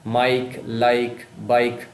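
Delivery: Mike, like, bike very slowly, word by word; stressed vowel. Shown as a demonstration. Teacher picking out the matching words, Mike, like, bike drawn out. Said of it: "आई sound का जो है repetition हो रहा है".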